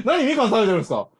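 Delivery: 笑いながら. Real, not acted. つまみ食いに笑いながら突っ込む.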